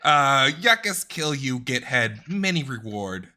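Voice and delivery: loud broken-English warrior threat; angry shout. Acted. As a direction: bellow it at the enemy, furious. Character yells threat at villain.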